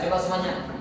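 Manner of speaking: ordinary talk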